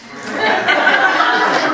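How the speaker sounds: breathy burst of real laughter; a real high cackle; high unvoiced laugh. Real, not acted. Laughs about bedtime.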